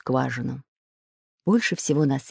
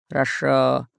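grudging agreement; 4 semitones lower, slower than usual. Acted. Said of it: "согласился Буль-Буль".

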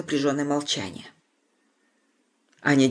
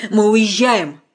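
loud, high, breathless; breathless excitement; 4 semitones higher, 7 dB louder, much slower than usual. A narrator's reading. Anya announces leaving, breathless and agitated. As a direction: blurt it out, agitated. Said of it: "задыхаясь от волнения".

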